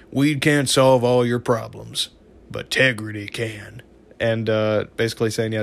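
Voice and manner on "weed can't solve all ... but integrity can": slow imitation Texas narrator drawl; Southern accent. Narrator closing the episode, imitated slow Southern drawl. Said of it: "like, this Southern accent".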